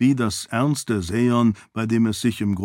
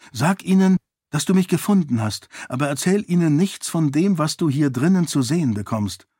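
higher, urgent instructing voice; princess giving orders; 6 semitones higher, faster than usual. Acted. Princess tells servant what to reveal, higher firm instruction. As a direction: instruct the servant firmly, pitched up.

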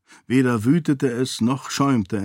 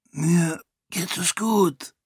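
slow, higher, croaked and ashamed; a weak croak, higher and slow; 7 semitones higher, slower than usual. A narrator's reading. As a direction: force the words out in a croak, embarrassed. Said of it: "krächzte Raoden beschämt".

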